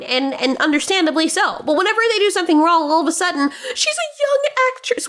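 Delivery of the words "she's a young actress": high-pitched mock pleading; mocking defenders. Defenders excusing her wrongdoing, mocking high voice. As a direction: mimic a coddling excuse, much higher.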